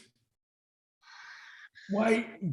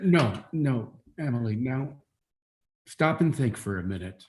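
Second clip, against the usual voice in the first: gentle; 7 semitones lower, 5 dB quieter than usual. Acted. Father calms daughter.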